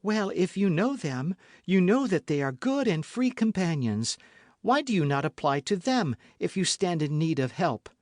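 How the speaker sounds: lightly voiced eager girl; young novice voice. A narrator's reading. Novice voiced in read story.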